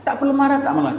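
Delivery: airy no need to be angry; mock calm. Shown as a demonstration. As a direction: toss it off lightly, high up. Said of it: "Kerana marah".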